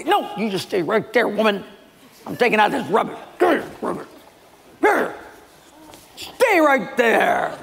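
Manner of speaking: high, strained husband in a temper; hammed-up angry chore-doing; acted huff. Husband storming off in acted quarrel.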